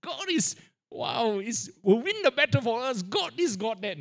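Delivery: high, mocking singsong of hollow praise; mocking churchgoers' shouting. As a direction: parody the crowd shouting empty slogans. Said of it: "You know how we all Christians gathering?".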